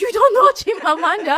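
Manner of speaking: high-pitched, incredulous question through laughter; laughing mock astonishment; retold surprise. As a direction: re-enact stunned disbelief, voice shooting up. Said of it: "I was so surprised".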